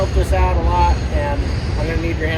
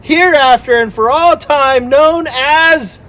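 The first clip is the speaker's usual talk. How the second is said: higher, slow, building to a big reveal; grand announcement; 7 semitones higher, 4 dB louder, much slower than usual. Pretend. Boat owner unveiling the new boat name, grand announcement. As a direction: draw it out and swell toward the name.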